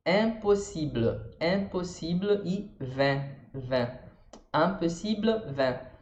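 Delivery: slow impossible and vin with the nasal IN; French nasal in. Shown as a demonstration. Teacher demonstrating the French nasal in, slowly. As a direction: impossible and vin, the IN pushed through the nose. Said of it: "I N é um som que não existe no português".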